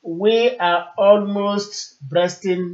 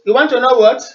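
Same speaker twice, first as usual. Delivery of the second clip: loud, raised question; to someone hard of hearing; 5 semitones higher, 7 dB louder, faster than usual. Real, not acted. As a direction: call it down a bad line. Asking caller to repeat.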